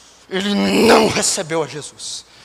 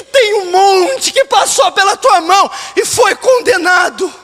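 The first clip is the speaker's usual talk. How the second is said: high, urgent shouted challenge; impassioned preaching; 13 semitones higher, 7 dB louder than usual. Real, not acted. Pastor asking how they can live knowing this, impassioned preaching. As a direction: confront the congregation with anguished force.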